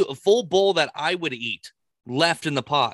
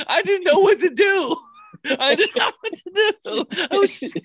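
high-pitched, laughing through the words; can barely get it out for laughing. Real, not acted. Telling her friend about the chat, laughing helplessly.

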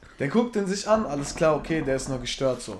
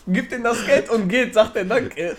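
laughing; 7 semitones higher, 7 dB louder, slower than usual. Real, not acted. Cracks up at own punchline.

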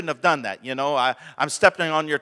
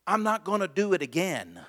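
normal talking voice

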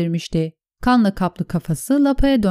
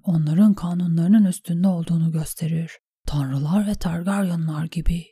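hushed, softer private musing; quiet inner monologue, held back from the narration; inner thought; 2 semitones lower, 3 dB quieter than usual. Acted. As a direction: think it through under your breath. Reads a character's inner thoughts.

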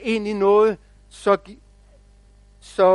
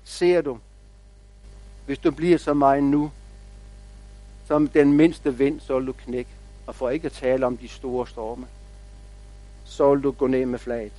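kindly big oak; 6 semitones lower, 5 dB quieter than usual. Acted. Big oak, branch round the little tree, gently warning. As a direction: gently caution the youngster in a lower voice. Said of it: "det store egetræ måske havde lagt en gren om".

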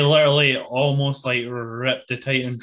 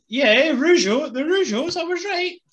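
triumphant; 4 dB louder than usual. Real, not acted. Triumphant, proven right.

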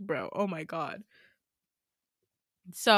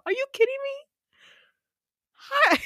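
much higher, incredulous squeal; outraged disbelief; 5 dB louder than usual. Real, not acted. Friend venting about Becca, incredulous high squeal. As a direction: shriek it in disbelief at her nerve.

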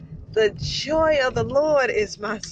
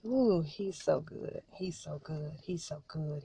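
much softer and lower, barely above a breath; awed murmur; 7 semitones lower, 12 dB quieter than usual. Real, not acted. Worshipper murmuring to herself about God's goodness, hushed awe.

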